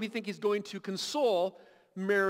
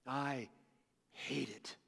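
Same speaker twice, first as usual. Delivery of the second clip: much lower and far softer, almost breathed; hushed repeat; 9 semitones lower, 11 dB quieter, slower than usual. Acted. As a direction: drop to a near-silent, heavy "hate it". Voicing Jesus hating death.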